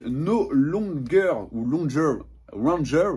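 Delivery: slow, clumsy attempt at an English accent; bad English accent. Real, not acted. Manga reviewer fumbling an English title for viewers, bad English accent. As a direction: stumble slowly through English with a French accent. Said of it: "mon accent anglais est à chier".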